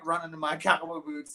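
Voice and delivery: ordinary talk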